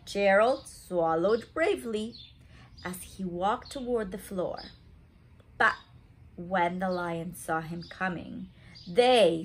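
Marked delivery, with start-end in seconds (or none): none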